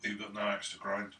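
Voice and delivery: usual speaking voice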